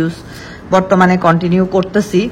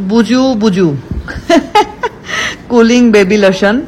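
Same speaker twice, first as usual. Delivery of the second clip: laughing; 2 semitones higher, slower than usual. Real, not acted. Laughs at brand name.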